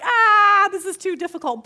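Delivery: high, loud mock wail of despair; hammed-up crybaby complaint; whiny complainer. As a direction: whine it like a fed-up student. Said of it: "people are gonna be like".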